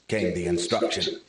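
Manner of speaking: usual speaking voice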